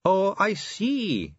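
drawn-out knowing reply; slow realisation. A narrator's reading. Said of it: "she said".

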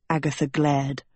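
plain reading voice

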